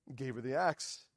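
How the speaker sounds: ordinary talk, nothing marked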